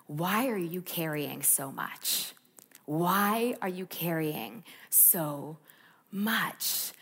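slow, soft, tender question; gentle voice of the Lord. Retold or imitated. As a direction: ask it slowly, like a loving whisper of God. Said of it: "I heard him say to me".

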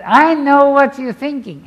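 loud, firm proclamation of Jesus; Jesus, commanding. Retold or imitated. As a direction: declare it with full authority. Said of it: "But Jesus says".

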